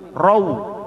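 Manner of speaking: "rau" ending in a clear u; wawu seperti u. Shown as a demonstration. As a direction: glide "rau" firmly up to an oo sound. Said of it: "wawunya seperti u". ("rau" diakhiri bunyi u yang jelas)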